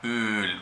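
Öl with a long Ö. Shown as a demonstration. Teacher contrasting long Ö in Öl. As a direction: stretch the Ö in Öl. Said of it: "en este caso es larga".

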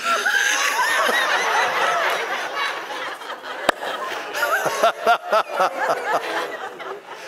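real helpless laughter, high and long; long high laughing fit. Real, not acted. Preacher cracking up in the pulpit. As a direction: let the laugh run away with you.

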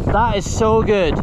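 loud, high, stretched praise; excited. Real, not acted. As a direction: rave about it. Praising the trail.

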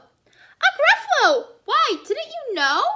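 high, surprised mouse voice; high-pitched know-it-all mouse; boastful little mouse. Acted. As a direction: pipe it up as if everyone knows.

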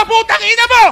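loud, high cursing yell; angry shout. Real, not acted. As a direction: shout the insult at your rival. Angry trash-talk quarrel in game.

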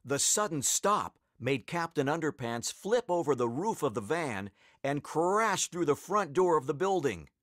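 ordinary delivery, nothing marked

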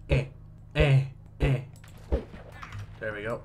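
ordinary talk

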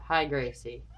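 normal talking voice

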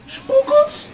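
very high silly squeak; squeaky voice. Pretend. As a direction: squeak it like a cartoon.

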